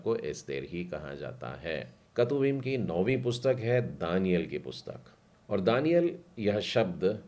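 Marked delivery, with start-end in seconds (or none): none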